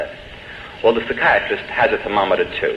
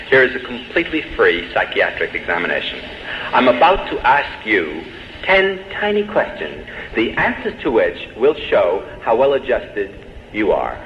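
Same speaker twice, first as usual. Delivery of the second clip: ordinary talk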